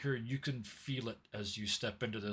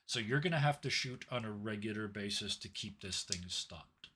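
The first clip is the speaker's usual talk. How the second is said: normal talking voice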